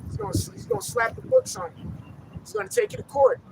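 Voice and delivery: ordinary delivery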